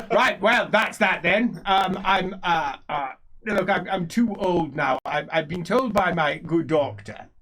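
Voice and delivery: loud, rambling speech with stumbles; blustering old nobleman. Acted. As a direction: bluster on as an old man past caring. Game master plays old character.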